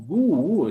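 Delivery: "gu, u" with the U isolated; U sounded. Shown as a demonstration. Teacher repeating the U for the class, isolated sound. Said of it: "la U".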